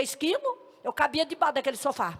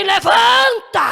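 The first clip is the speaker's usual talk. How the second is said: long, high, booming shout; commanding voice; 6 semitones higher, 10 dB louder, much slower than usual. Retold or imitated. A heavenly voice orders the frightened girl up, drawn-out commanding shout. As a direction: bellow the order, drawing it out. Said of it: "ouvi uma voz que falou assim".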